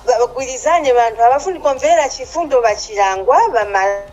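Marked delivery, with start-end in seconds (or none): none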